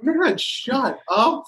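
high-pitched exasperated brush-off. Retold or imitated. Player fed up with teammate's texts, exasperated. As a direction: cut him off, voice jumping up high.

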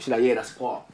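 usual speaking voice

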